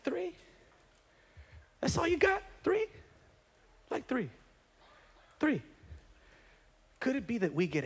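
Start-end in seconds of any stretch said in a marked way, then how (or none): 0.0-5.6 higher, softer mocking "three?"; incredulous. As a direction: scoff at how little he did. Prophet mocks three shots.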